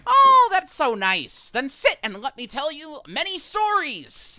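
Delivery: high, loud and slow delight; delighted NPC. Acted. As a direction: gush over the kind words. NPC delighted by player's kindness.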